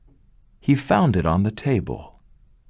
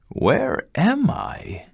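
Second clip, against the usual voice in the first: puzzled wonder; 12 semitones higher, much slower than usual. Acted. Waking confused in strange house.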